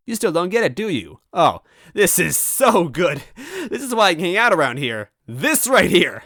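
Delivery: high, loud gleeful mocking; gleeful trickster. Grey toad mocking the robot, gleeful. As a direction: savor the joke, teasing.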